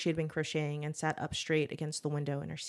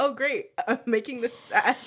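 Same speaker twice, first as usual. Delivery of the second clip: mock dismay; 7 semitones higher, 5 dB louder than usual. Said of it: "'Cause I was like".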